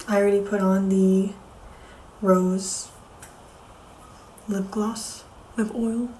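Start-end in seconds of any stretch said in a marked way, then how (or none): none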